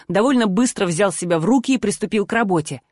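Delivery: usual speaking voice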